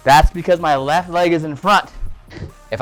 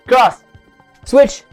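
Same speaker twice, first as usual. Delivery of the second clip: loud, higher calls; shouted drill commands; 6 semitones higher, 7 dB louder than usual. Shown as a demonstration. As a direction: bark out each strike. Calling out combo.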